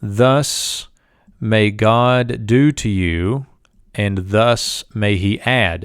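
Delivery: slow, measured gloss with pauses; slow deliberate reading. Shown as a demonstration. Teacher reading the verse line slowly for students. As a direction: lay out each phrase slowly and deliberately. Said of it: "So the first line is this".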